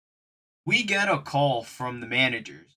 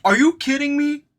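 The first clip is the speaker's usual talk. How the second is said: much higher, outraged manager voice; hammed-up frantic manager, much higher; upset manager; 11 semitones higher than usual. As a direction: squeal like a panicked boss. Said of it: "Abraham was like".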